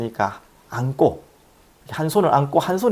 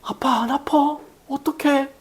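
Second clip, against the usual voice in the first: put-on high, childlike worried voice; worried little boy; 11 semitones higher, slower than usual. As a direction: play a small son fretting over dad. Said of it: "뭐라고 하는지 알아요?".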